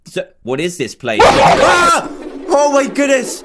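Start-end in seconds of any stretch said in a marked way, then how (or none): 1.2-2.1 loud high scream of fright; startled scream. Real, not acted. Player is ambushed in the game, screaming. Jump scare in horror game. 2.5-3.4 high, shaken exclamation; shocked. Real, not acted. Player reels from a jump scare, shocked.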